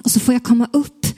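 plain reading voice

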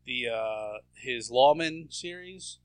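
ordinary delivery

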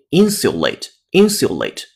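slow, crisp British "insulate" with "syu" and full "ate"; British pronunciation. Shown as a demonstration. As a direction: slowly model the British "IN-syu-late", twice. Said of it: "英音insulate".

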